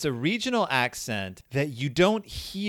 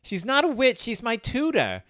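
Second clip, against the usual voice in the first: higher-pitched imitation of a New Jersey kid; mock New Jersey accent; 8 semitones higher than usual. Co-host echoing a TV kid's line, mock New Jersey accent. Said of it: "their accents are bonkers in this episode".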